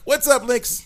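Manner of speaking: high, loud hype greeting; excited shout-out. Real, not acted. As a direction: call out his name with hype.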